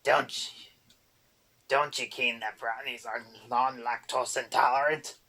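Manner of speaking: loud goblin brogue, ranting; angry boggart. A narrator's reading. Boggart rants at Erder about the cream, angry goblin brogue. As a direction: scold her like a cranky goblin.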